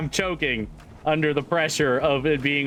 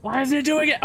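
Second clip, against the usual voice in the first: high exasperated whine; 12 semitones higher than usual. Real, not acted. Exasperated at failing trick.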